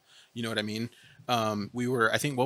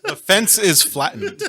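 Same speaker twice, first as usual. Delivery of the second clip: laughing; 9 semitones higher, 11 dB louder than usual. Real, not acted. Joking reply amid group laughter.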